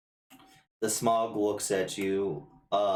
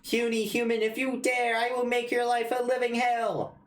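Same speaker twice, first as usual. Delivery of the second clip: tiny villain voice; 13 semitones higher, 3 dB louder than usual. Acted. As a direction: squeak out grand threats like a tiny overlord.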